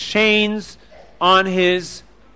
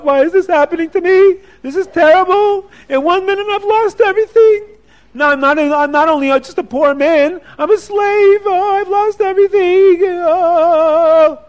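high, loud wailing lament; despairing sob of a man who lost it all; crying to himself; 11 semitones higher, 5 dB louder than usual. Acted. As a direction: moan it out high, like everything is gone. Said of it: "he starts to cry".

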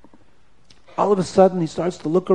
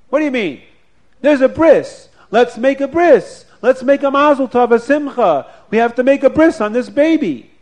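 higher and louder, rousing the room; insistent excitement; 8 semitones higher, 6 dB louder than usual. Acted. Shlomo rallies the grieving family to make the bris: higher, louder, excited. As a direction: push them to celebrate now, full voice.

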